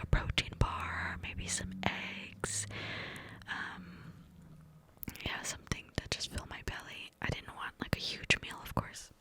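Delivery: ordinary delivery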